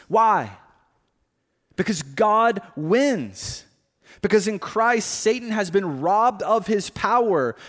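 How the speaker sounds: high, loud, impassioned sermon voice; preaching at full force. Acted. Pastor urging the congregation that God wins, preaching at full force. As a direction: build to a ringing proclamation.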